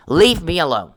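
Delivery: final leave me alone rising into a shout; mock yell. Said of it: "hit the table about four times".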